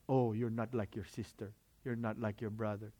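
lightly voiced Filipino parent accent; a hint of a comparing Filipino parent. Filipino parent comparing child to a sibling, light Filipino accent. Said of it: "That's how my parents sound".

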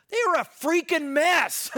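loud, high preacher's outburst; emphatic exclamation. Real, not acted. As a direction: punch it out to the congregation. Preacher exclaims over Corinth's mess.

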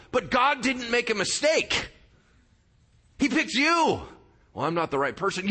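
high, loud, urgent preaching; preaching at full force. Acted. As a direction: preach it hard to the congregation.

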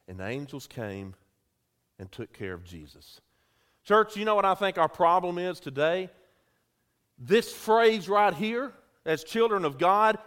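ordinary talk, nothing marked

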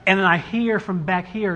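ordinary talk

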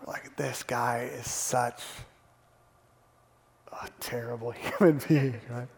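soft breathy whisper with a sigh; preacher whispering his disgust at the servant; whispered aside. Real, not acted. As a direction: confide it under your breath. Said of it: "aside on the terrible servant".

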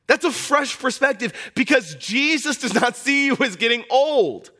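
high, loud preacher's cry; full-force preaching. Acted. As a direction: preach it out to the whole church.

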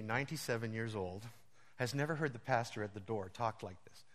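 ordinary delivery

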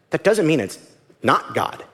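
normal talking voice

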